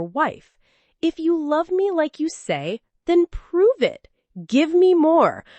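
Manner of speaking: high, scornful demands, pushing harder; scoffing. A narrator's reading. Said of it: "She scoffed".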